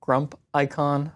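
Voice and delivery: Grumpicon said as "grump-EYE-con"; long-i pronunciation. Shown as a demonstration. Unsure how you pronounce the tool's name, long-i Grumpicon, "grump-eye-con". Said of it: "not really sure how you pronounce it".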